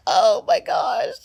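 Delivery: slow, loud, half laughing; laughing disbelief. Retold or imitated. Reacting to the congratulations text, laughing in disbelief. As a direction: groan it through a laugh.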